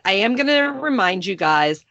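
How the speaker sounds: usual speaking voice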